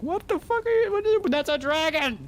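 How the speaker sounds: very high-pitched panicked squeal; mock-terrified yelp, voice shooting way up; Ron. Friend mimicking Ron meeting a dragon, mock panic. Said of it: "And Ron was like".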